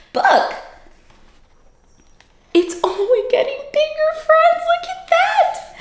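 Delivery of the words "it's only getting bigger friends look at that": high, thrilled; excited. Acted. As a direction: share the wonder with the kids. Excited to kids.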